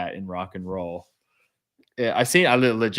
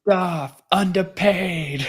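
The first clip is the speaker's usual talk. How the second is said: high, drawn-out playful call; mock lament; 9 semitones higher, 3 dB louder, slower than usual. Teasing about the band's first deal, high drawn-out mock lament. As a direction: stretch it out high, breaking into a laugh. Playful echo of co-host's verdict.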